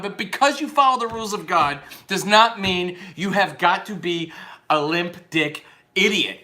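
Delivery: normal talking voice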